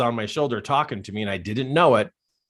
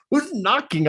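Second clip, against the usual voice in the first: much higher, baffled question; startled confusion; 14 semitones higher than usual. Retold or imitated. Man in truck hears a knock, startled. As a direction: act out being thrown by a sudden sound.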